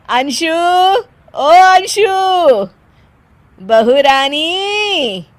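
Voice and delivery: long drawn-out summoning call. Acted. Mother-in-law summoning daughter-in-law to meet friends, calling out. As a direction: sing out the name to fetch her.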